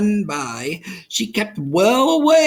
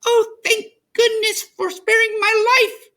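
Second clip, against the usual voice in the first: high, squeaky little mouse; a small grateful mouse voice; relieved little mouse squeak; tiny mouse; 3 dB louder than usual. A narrator's reading.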